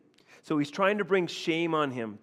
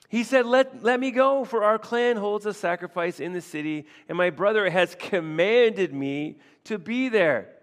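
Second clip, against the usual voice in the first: much higher, louder earnest plea; eager pleading; 6 semitones higher, 6 dB louder than usual. Retold or imitated. David asking leave for the family feast, a higher pleading voice. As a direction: beg to be let go. Voicing David's request via Jonathan.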